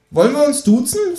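high squeaky kangaroo voice. Acted. Cheeky kangaroo asking the author, high voice. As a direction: pitch it way up as the Kangaroo. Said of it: "fragt das Känguru".

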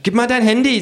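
hohe Figurenstimme, fordernd; hoch und bestimmt auffordern; Känguru. Acted. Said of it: "sagt das Känguru". (hohe Känguru-Stimme)

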